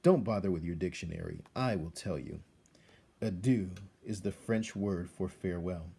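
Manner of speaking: plain reading voice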